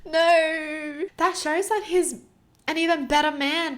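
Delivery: high, loud, laughing through tears; laugh-crying, voice cracking; real half laugh, half sob; about to cry. Real, not acted. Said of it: "I'm gonna cry".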